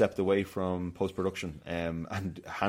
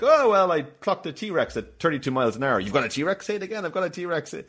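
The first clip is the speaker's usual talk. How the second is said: mock bragging dialogue; 7 semitones higher, 3 dB louder than usual.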